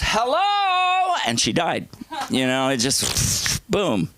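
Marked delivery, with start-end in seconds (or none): 0.0-1.1 very high, held-out hello; mock high-pitched hello; singsong greeting. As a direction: imitate mom calling out a long, high greeting. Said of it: "finally one day she goes".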